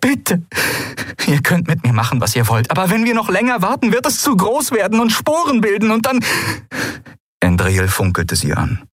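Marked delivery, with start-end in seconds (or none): 0.0-6.2 high, desperate plea rising steadily; urgent pleading. Acted. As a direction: plead as if time is running out. Lien pleads urgently to stop the thing. (flehend, dringlich, immer höher)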